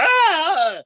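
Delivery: high shrieking mock scream; girlish scream. Comic reenacting his scream at the possum, a high shriek. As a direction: shriek like a thirteen-year-old girl. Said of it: "I screamed, I was like".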